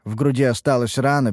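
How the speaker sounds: ordinary delivery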